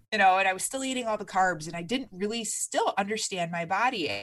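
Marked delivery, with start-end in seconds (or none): none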